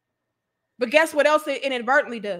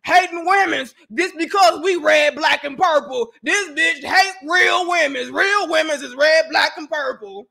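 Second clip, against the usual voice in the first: loud, indignant mock rant; mocking angry critics; 2 semitones higher, 4 dB louder than usual. Streamer mocking critics in a loud indignant rant. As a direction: ham up a furious commenter. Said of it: "That's how they be".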